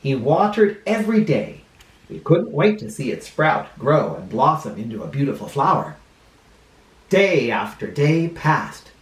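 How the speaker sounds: put-on lively storybook voice for kids; reading to children. A narrator's reading. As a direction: read it like a children's librarian.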